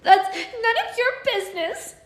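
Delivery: fairy sobbing at the nosy twins. Acted. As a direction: cry it out, hurt and defensive. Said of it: "the fairy said and sobbed".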